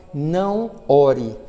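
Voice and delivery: very slow, drawn-out "Não ore", stressing "não"; slow deliberate repeat. Acted. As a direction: drag the warning out, calm and heavy. Repeated slowly after loud take.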